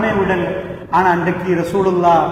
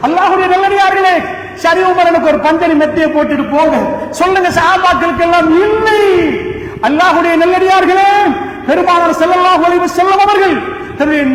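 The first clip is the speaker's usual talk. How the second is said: high, loud ringing exhortation; full force preaching; 8 semitones higher, 4 dB louder than usual. Acted. Preacher exhorting the faithful, loud and high. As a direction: call out to the congregation.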